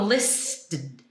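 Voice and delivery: "listed" squeezed into "list-d"; awkward "listed" with D jammed onto the T; missing vowel. Shown as a demonstration. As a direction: try "listed" without its second vowel. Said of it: "it's really hard to do".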